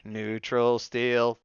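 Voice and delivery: very slow, stretched "Neutral steel"; drawn-out demo. Shown as a demonstration. Co-host showing how neutral steel should sound, slow drawl. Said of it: "it should be like this".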